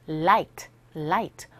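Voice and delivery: "light" with tongue tip on the upper teeth; low-pitched "light", clear tongue-tip L; L sound. Shown as a demonstration. Teacher shows practised contrast with R, "light" on a tongue-tip L. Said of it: "L 사운드는 혀끝이 윗니 끝에".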